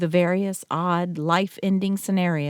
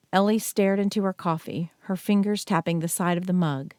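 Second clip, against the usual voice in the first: ordinary delivery, nothing marked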